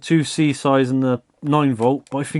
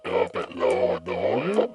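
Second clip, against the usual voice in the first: much lower, dragging voice changer; slowed tape voice; 13 semitones lower than usual. Shown as a demonstration. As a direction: sound like a chewed-up cassette tape. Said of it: "like someone's chewed up my cassette tape".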